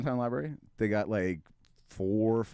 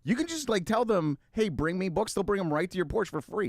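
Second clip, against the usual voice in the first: bright, much higher ad-announcer voice; mock ad read; 10 semitones higher than usual. Host pitching the library in a much higher ad voice. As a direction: sell the library like a commercial.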